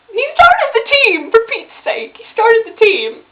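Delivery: high, loud, drawn-out whine; upset protest. Real, not acted. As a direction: plead his case in distress. Upset fan protesting character's death.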